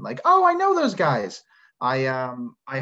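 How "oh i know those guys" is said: high, louder burst of delight; excited recognition. Acted. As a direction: blurt it out like spotting someone you know. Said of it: "this little moment of excitement".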